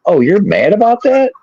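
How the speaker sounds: husband feigning surprise at his upset wife; mock surprise. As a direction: play dumb, surprised she's upset. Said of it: "I was like".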